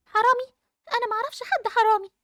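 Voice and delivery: high, startled questioning; incredulous child's question; shocked echo. Acted. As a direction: throw the word back in disbelief, pitch rising. Accused of knowing a thief.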